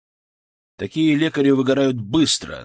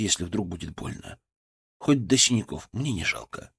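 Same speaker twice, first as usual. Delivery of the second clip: soft reassuring; 6 dB quieter than usual. A narrator's reading. Medic reassures scared patient.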